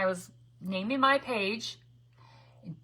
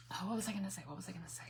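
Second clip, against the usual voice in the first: whisper; 13 dB quieter, much faster than usual. Real, not acted. Losing her train of thought.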